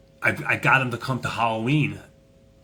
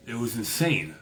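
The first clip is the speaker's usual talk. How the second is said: ordinary delivery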